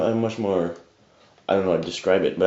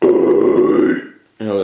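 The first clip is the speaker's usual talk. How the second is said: growl demo; 8 dB louder than usual. Shown as a demonstration. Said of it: "bring you that, uh".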